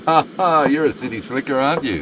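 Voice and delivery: imagined laughing tease. Retold or imitated. The lady laughing off a tourist's mistake, playful teasing. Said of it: "She could have just laughed and said".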